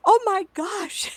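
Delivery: high, slow amazed oh my gosh; excited gasp. Retold or imitated. Psychic recalling seeing two souls blend, amazed high gasp. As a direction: gasp it out in wonder. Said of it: "I'm excitable".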